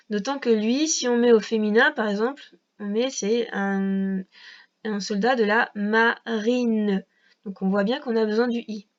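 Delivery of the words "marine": "marine" drawn out as ma, then rine; slow two-part "marine"; syllables sounded out. Shown as a demonstration. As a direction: stretch "marine" so the i is heard. Découpe le féminin pour montrer le i.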